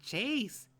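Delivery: high, loud cry of a name; alarmed shout. Acted. TJ calls out after fall.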